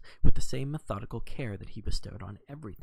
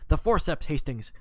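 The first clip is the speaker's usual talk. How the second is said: higher, louder urgent call for the forceps; sudden exclamation; 5 semitones higher, 5 dB louder than usual. A narrator's reading. Detective calls to his friend for the forceps, excited. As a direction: snap it out as a sudden find. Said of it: "a faint exclamation".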